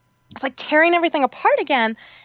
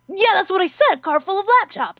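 higher-pitched, put-on insistence; insistent inspector; 8 semitones higher, 3 dB louder than usual. Car inspector correcting the traveler, mock insistence. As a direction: repeat it as an exasperated inspector, pitched up.

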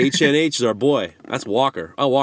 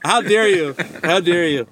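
hammed-up how dare you; mock offence; 6 semitones higher than usual. Scolding the joke sender in mock outrage.

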